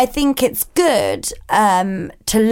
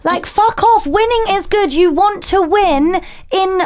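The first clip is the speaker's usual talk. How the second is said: higher, fired-up rant against no cheering; heated rant; 7 semitones higher than usual. Real, not acted. Mother ranting to co-host about sports day, heated. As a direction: get worked up and let it out.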